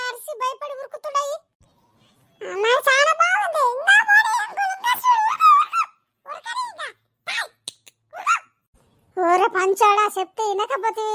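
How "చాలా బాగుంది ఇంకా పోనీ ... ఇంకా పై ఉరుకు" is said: high, loud, squealing delight; thrilled child shout. Acted. Child on the cart shrieking at uncle to go faster, loud. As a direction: cheer the bulls on to run faster.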